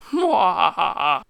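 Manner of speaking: slow shouted villain laugh; short evil cackle. Pretend. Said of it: "plays out like this".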